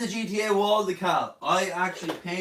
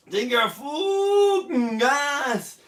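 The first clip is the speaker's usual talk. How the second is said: loud quoted shout; 9 semitones higher, 6 dB louder, much slower than usual. Retold or imitated. Quoting a fan's message.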